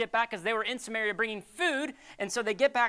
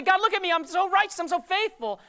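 smug self-praise; 7 semitones higher, 5 dB louder than usual. Retold or imitated.

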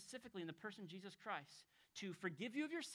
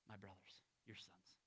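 much lower and softer, almost breathed; hushed tender words; 12 semitones lower, 16 dB quieter than usual. Acted. Preacher voicing Jesus to the Father, soft and low. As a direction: speak it as a tender hush.

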